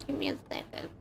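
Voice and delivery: whispered, stepping away; whispered aside. Real, not acted. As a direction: breathe it quietly off mic. Stepping away to blow nose.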